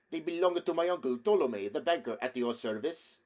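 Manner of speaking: put-on eager Italian-accented reply, starting high and settling. A narrator's reading. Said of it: "noticeably Italian accent".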